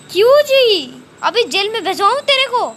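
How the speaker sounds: loud, stern rebuke from the bench; angry threat. Acted. Judge threatening the principal with jail, loud and angry.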